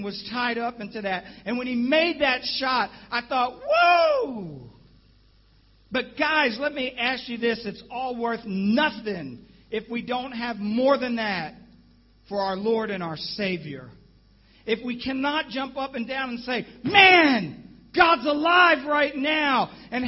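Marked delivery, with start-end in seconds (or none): 3.6-4.7 much higher astonished whoa; high, amazed whoop; retold whoa. Winning shot goes in. 16.8-19.7 loud, slow, higher preaching shout; rousing preacher's cry. Acted. Preaching at full force.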